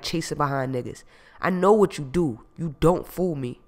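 loud, heated accusing rant; angry accusation. Acted. Jamal ranting at Gina on the phone, furious. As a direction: hammer her with blame.

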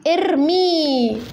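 ارمي stretched very slowly; slow model word. Shown as a demonstration. Teacher sounding out the root for students, very slow. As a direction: hold each sound of ارمي. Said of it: "أصلها ار-،".

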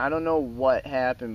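normal talking voice

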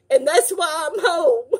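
choked up thanking viewers for prayers; crying. Real, not acted. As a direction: voice cracking as she cries with gratitude. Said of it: "but I'm gonna cry because it's from the heart".

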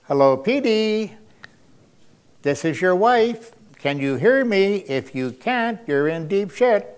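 lightly voiced wife calling her husband; imitating wife. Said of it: "you hear a voice saying".

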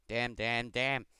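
1 frustrated shout. Real, not acted. Gamer frustrated after losing flag.

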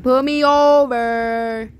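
loud, slow, stretched-out call; drawn-out yell. Real, not acted. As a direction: holler it out, dragging the last word. Surprised police have not stopped them.

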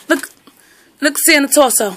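usual speaking voice

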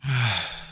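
long tired sigh out; deep sigh. Acted. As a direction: breathe in deep, then let it go. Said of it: "took a deep breath and sighed".

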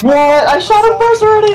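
furious high shout; raging gamer. Real, not acted. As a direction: yell it in outraged disbelief. Killed by enemy, raging.

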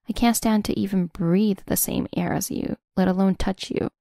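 ordinary delivery, nothing marked

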